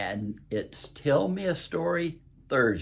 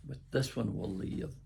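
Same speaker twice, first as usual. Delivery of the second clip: plain reading voice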